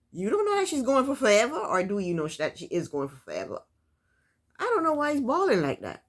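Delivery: usual speaking voice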